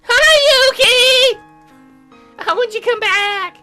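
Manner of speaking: high, drawn-out squeal of hello; excited greeting. Acted. Esseline greets Yuki at the coffee place, excited greeting. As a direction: gush it loud and high, stretching the words.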